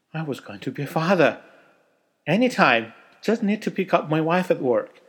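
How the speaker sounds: loud, high, eager burst; eager excitement. A narrator's reading. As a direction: rush the words out in excitement. Narrator overjoyed, eager.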